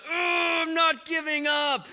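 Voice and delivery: high, strained cry of refusal. Retold or imitated. Elijah praying on after bad news, crying out. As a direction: groan into a high, determined cry. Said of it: "Starts crying out".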